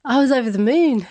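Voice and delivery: over the moon. Real, not acted. Joyful recollection.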